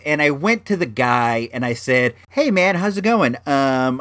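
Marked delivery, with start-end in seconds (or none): 2.3-3.3 higher, friendly nice-guy greeting; nice sweet guy. Retold or imitated. Buyer greeting the flea market seller, nice sweet guy voice. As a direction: greet him sweetly, pitched up. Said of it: "my nice sweet guy voice".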